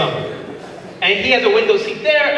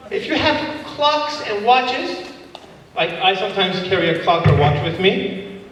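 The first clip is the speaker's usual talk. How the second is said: usual speaking voice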